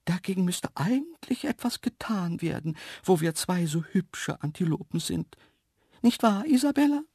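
high, delicate creature voice; vain dainty antelope. A narrator's reading. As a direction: preen a little in a high antelope voice. Vain antelope talks to companion. (eitle, zarte Antilopenstimme)

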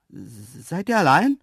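calling back. Acted. Narrator to antelopes outside, calling back. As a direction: call out warily and drawn out. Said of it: "rief ich zurück".

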